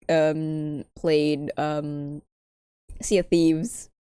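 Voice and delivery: usual speaking voice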